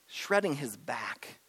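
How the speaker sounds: normal talking voice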